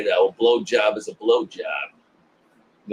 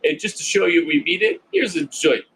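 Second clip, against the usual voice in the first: higher, coaxing mock voice; put-on friendly offer, pitched up; imitated bullies; 6 semitones higher than usual. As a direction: play a bully pretending to make peace.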